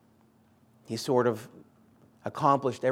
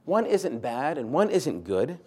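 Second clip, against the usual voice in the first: ordinary talk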